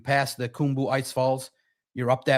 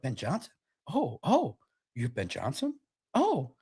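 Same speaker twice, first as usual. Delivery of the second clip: much softer, hushed dawning recognition; an interviewer suddenly impressed; awed surprise; 6 dB quieter than usual. Pretend.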